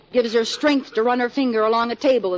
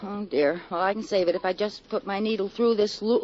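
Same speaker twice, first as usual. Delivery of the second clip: ordinary delivery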